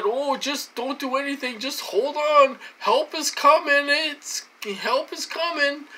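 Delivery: high, sing-song mockery of false comfort; mocking quote. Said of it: "And they said".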